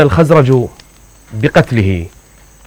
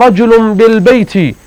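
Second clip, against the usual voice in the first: wounded man crying out; 8 semitones higher than usual. Retold or imitated. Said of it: "فقال".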